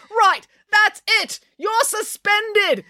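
high, drawn-out angry shout; teacher losing his temper, yelling. Acted. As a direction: explode at the pupil. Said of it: "yelled Mister Lang".

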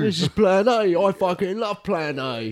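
high, slow gloating sing-song; gleeful cop. Retold or imitated. Cop dangling a man off a roof, gleeful high gloating. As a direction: savour it like a happy maniac. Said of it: "acted cop gloating".

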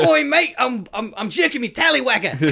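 hammed-up cockney bloke; mock cockney. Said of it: "British people are just so funny".

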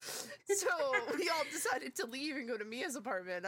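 ordinary talk, nothing marked

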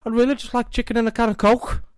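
very high, loud put-on Scouse; squeaky exaggerated Liverpool accent. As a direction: do a high-pitched comic Scouser. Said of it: "were that a Scouser one".

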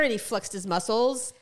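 usual speaking voice

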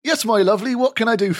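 West Country barkeep greeting a customer; lightly done West Country innkeeper. Acted. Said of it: "they're all from the West Country".

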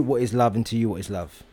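ordinary talk, nothing marked

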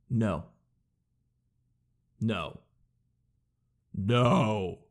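firm "No." dropping low; decisive low no. Shown as a demonstration. Refusing the tea shop clerk, decisive low no. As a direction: shut it down with a low "No.".

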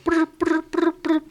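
high buzzing brr noises; notification sounds. Retold or imitated. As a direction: mimic rapid message pings. Imitating phone notifications going off.

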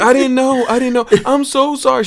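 high, fluttery apology in an imitated teacher voice; apologetic substitute teacher. Substitute apologizing to the pretend-deaf kid, high mock voice. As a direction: squeak out the sorry like the flustered substitute. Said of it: "And she was like".

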